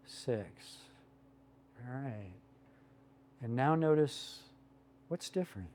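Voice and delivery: soft, slow counting for breaths; calm breathing coach, unhurried; guided breathing. Acted. Leads a group breathing exercise.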